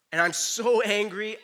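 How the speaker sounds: indignant scripture quote; angry. A narrator's reading. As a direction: read Jonah's anger with force. Jonah's angry answer read aloud.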